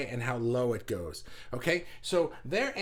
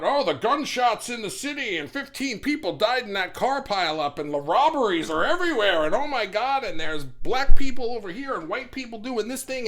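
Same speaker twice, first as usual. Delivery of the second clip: hammed-up alarmed rush, higher and louder; breathless doom-scroll panic, building louder; mock news panic; 5 semitones higher, 8 dB louder than usual. Vlogger mocking the scary news, hammed-up alarm. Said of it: "they can hear about".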